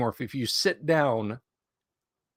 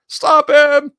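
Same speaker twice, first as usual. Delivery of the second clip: high, loud mock cry to stop him; shrill cartoon alarm; mock shout; 13 dB louder than usual. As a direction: yell it like a panicked crowd. Mock cry of chat flagging.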